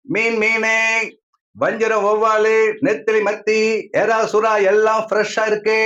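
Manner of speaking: high, chanting street-vendor call; fish seller's cry. Acted. Fish seller calling out wares.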